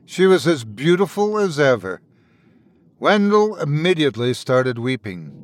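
ordinary delivery, nothing marked

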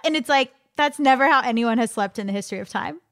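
ordinary talk

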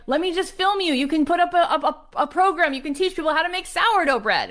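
high, excited retold daughter; acted keen pleading; eager persuading. Daughter pressing her mother, retold.

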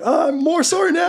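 high, sheepish apology with a laugh; sheepish orc. Acted. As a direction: apologize high and bashful while drifting. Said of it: "she's like".

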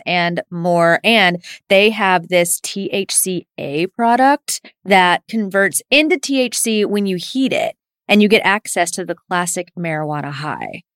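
loud, upbeat sponsor read. Acted. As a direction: sell it like a podcast ad.

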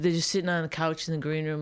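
usual speaking voice

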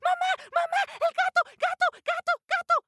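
put-on very high squeaky cries of terrified baby mice; panicked squeaky mice. Little mice screaming again.

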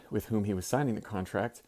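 normal talking voice

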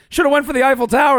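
fast, high mock disbelief; incredulous. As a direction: squeal it like you can't believe it. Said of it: "she's like".

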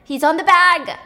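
loud excited shout; cheering shout as he lands; scream. Retold or imitated. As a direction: yell out in triumph. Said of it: "and they scream".

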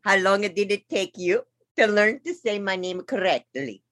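a hint of a put-on Italian accent. [name] greets host Jesus, light Italian accent. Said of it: "And in that accent".

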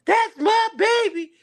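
very high, slow, proud mother's cry; proud mom. Retold or imitated. Mother grabs the mic as her son scores, very high proud cry. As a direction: imitate a thrilled mom grabbing the mic. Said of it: "and she goes".

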